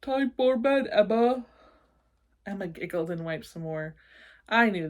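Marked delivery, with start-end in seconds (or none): 0.0-1.5 louder stuffy monster voice; snuffly slime monster talking to a toddler; stuffed nose. Acted. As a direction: keep the blocked nose going. Agatha speaking, same stuffy voice.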